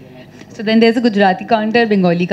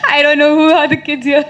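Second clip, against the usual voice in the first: laughing impression; 3 semitones higher than usual. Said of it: "Papa Rao is like".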